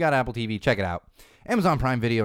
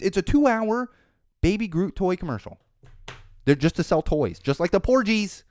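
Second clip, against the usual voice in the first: higher, louder exasperated rant; building rant; 9 semitones higher, 5 dB louder than usual. Real, not acted. Podcaster ranting about Baby Groot toys, exasperated. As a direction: vent louder and louder.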